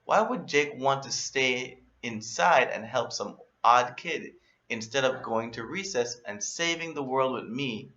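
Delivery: usual speaking voice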